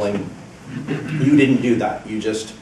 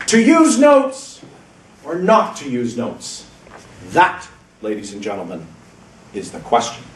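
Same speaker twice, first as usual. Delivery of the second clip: theatrical voice; 3 dB louder than usual. Shown as a demonstration. Evaluator shows the speaker a theatrical opening hook, grand stage declaiming. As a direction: proclaim it like a Shakespearean actor on stage. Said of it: "done a theatrical voice with it".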